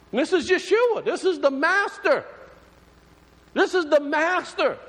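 high, ringing proclamation of the master; excited pulpit proclamation; fervent exclamation. Real, not acted. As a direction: lift it high in awe. Preacher exclaims about Yeshua.

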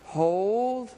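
one long, drawn-out steady word; sustained, intoned restraint; voice of the Spirit. Retold or imitated. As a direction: hold the word out like an inner command. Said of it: "I could hear the Spirit".

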